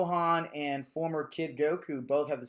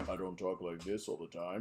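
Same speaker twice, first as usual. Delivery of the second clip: much lower and softer, a mock deep man's voice; put-on deep voice; 8 semitones lower, 8 dB quieter than usual. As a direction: drop into a fake bass voice. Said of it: "other men that have just, like".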